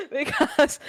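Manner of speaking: helpless laughter breaking up the words; laughing. Real, not acted. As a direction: try to explain while cracking up. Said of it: "I'm laughing because".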